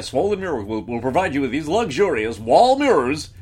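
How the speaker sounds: fast, punchy TV ad announcer. Retold or imitated. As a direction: sell it like a late night ad. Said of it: "working on my little announcer voice".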